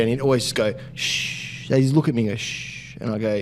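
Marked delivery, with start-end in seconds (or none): 0.9-1.7 unvoiced hushing noise; mock "shh"; imitated shush. 2.4-3.0 soft, unvoiced shushing noise; mock conspiratorial "shh"; imitated shush. Imitates the man's shushing back.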